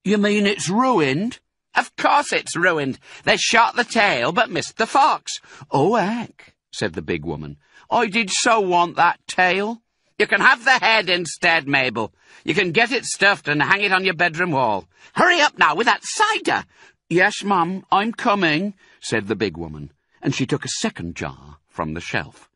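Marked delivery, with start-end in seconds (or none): 0.0-5.4 woman voice. A narrator's reading. Women calling between floors. 5.7-18.7 woman voice. A narrator's reading. Women calling between floors.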